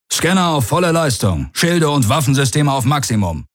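high, emphatic command; commander's order. A narrator's reading. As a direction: call orders across the bridge.

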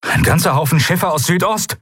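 high, urgent lookout report; called-out warning. A narrator's reading. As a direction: report incoming ships across the bridge. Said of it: "rief Kai von seiner Stellung".

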